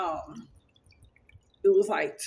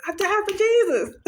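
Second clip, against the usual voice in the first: high, loud proud declaration; excited; 11 semitones higher, 6 dB louder than usual. Retold or imitated. Wife telling husband she died for Jesus, high loud proud. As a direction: announce it like good news. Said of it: "I was so excited".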